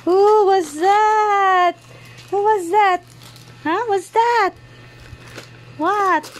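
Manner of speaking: put-on high-pitched baby-talk wonder; playful amazement. Feigned surprise at baby's gift.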